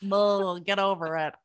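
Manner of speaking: loud mock brush-off; mock dismissive. Husband tells crying wife to move on, mock dismissive. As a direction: blurt it out as a teasing, unimpressed husband.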